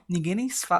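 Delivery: normal talking voice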